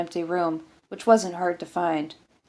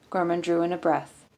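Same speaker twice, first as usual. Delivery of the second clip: ordinary delivery, nothing marked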